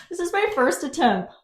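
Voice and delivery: usual speaking voice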